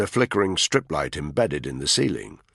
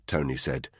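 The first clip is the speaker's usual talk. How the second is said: ordinary delivery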